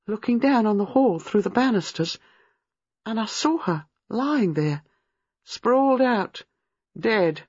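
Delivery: put-on high, halting, traumatised young woman; haunted recollection rising to high distress; horrified. A narrator's reading. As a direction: relive it in fragments, building to anguish. Reliving seeing a strangled woman.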